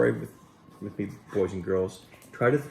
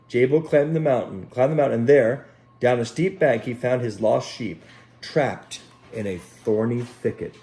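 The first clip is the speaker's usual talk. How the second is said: gentle storybook reading voice; unhurried picture-book narration; storytelling; 2 semitones higher, 7 dB louder than usual. Acted. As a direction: read it like a bedtime story for children. Children's story read aloud.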